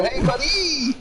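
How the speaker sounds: high, loud "Hey, buddy"; imitating the clerk. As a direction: sing it back high like the clerks did. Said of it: "And they'd go".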